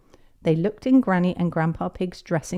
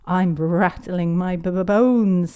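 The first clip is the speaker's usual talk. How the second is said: rattling stutter; 4 dB louder, slower than usual. Acted. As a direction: trembly rattle on "bones". Peppa rattling bones as a skeleton.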